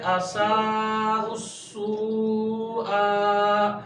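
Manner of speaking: असा उस्सू आ drawn out slowly, separate आ at the end; correct pronunciation demo. Shown as a demonstration. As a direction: stretch सा and सू, then sound आ from the throat. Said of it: "ये इसका सही तलफ़ुज़ है". (असा उस्सू आ, मद खींचकर, आ हलक से)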